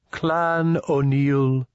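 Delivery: high, very slow, held-out intoning; ceremonial alien. A narrator's reading. Indui greets the clan with a bow, ceremonially. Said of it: "the Indui stated".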